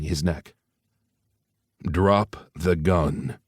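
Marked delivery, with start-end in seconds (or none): 1.8-3.4 very slow, cold and flat. Acted. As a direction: give the order icy and level. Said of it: "Ace's cold, flat tone".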